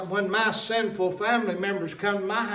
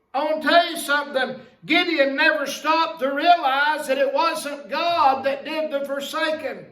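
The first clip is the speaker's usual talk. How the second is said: raised, driving sermon voice; fervent preaching; 4 semitones higher than usual. Acted. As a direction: preach it hard to the congregation. Preaching at full force.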